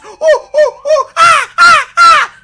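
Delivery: very loud, very high monkey shrieks; frantic chimp alarm calls; screeching monkeys. Pretend. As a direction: scream like panicked monkeys. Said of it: "screeching and skrilling".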